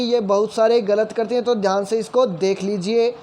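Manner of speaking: ordinary talk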